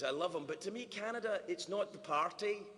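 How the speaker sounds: ordinary delivery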